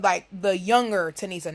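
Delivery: ordinary delivery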